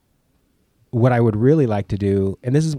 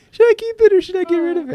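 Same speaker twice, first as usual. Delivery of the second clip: much higher, voicing his own dithering; high mock voice of doubt; 5 dB louder than usual. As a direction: play your fretful inner voice. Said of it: "going through everything and going".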